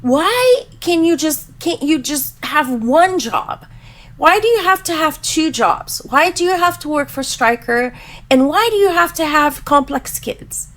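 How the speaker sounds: put-on high, pleading child voice through the whole complaint; imitating crying daughter. Mother voicing her daughter's tearful complaint in a high child voice. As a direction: whine it like an upset eight-year-old. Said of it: "And she's like".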